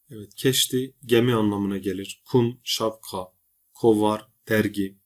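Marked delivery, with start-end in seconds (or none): none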